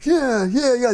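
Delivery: mock cheering viewer. Mocking action-movie viewer cheering.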